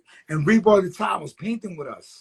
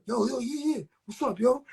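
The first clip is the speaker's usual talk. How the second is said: higher-pitched, perked-up hello; acted eager greeting; 6 semitones higher than usual. Man greeting a friend after his look changed, acted eagerness. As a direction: imitate him turning suddenly friendly. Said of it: "it, it just changed".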